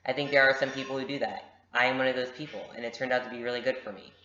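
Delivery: ordinary delivery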